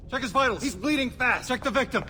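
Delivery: urgent orders. Acted. Police attending the wounded after shooting, urgent. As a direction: call out orders in an emergency.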